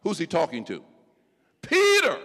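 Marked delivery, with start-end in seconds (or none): 1.6-2.2 a high, loud call of the name. Acted. Preacher calling Peter by name, high and loud. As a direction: call Peter across the room.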